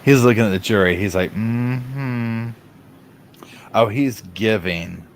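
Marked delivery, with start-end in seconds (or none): 1.3-2.5 mock attitude. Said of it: "he's giving attitude".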